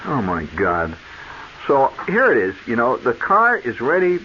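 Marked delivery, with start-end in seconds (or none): none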